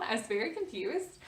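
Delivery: normal talking voice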